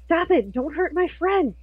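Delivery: high-pitched desperate cry to stop; urgent plea. Acted. Acted plea for a friend.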